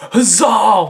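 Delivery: high, loud hammed-up cry; alien cheer. Aliens hailing the child hero, loud mock cheer. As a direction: belt out a mock-heroic cheer.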